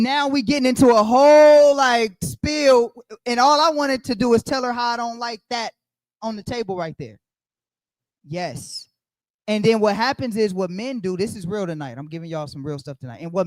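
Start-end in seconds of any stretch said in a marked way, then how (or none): none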